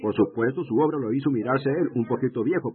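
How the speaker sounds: ordinary talk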